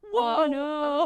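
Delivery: high, startled pull-back; startled whoa. Retold or imitated. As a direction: recoil from the accusation in surprise. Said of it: "And I'm like".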